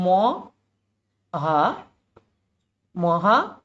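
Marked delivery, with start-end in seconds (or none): 0.0-1.7 drawn out very slowly, syllable by syllable. Shown as a demonstration. Teacher slowly sounding out the word for students, drawn out. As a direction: spell it out slowly for the class. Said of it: "কি লিখবো".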